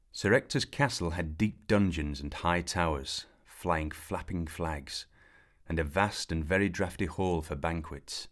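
ordinary delivery

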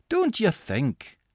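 slow, drifting, distracted; absent-minded. A narrator's reading. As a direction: say it as if thinking elsewhere. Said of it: "observed Angus absently".

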